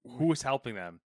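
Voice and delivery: usual speaking voice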